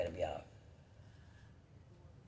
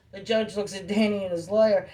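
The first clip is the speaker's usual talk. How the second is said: usual speaking voice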